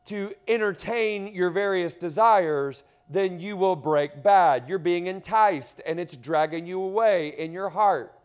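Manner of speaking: full-force sermon, loud and driving; preaching. Acted. Pastor preaching hard to the church, loud and driving.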